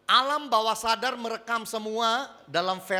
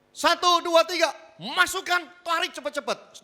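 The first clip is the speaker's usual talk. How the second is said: counting himself in; 10 semitones higher, 3 dB louder than usual. Acted. Acting out the blindfolded man counting.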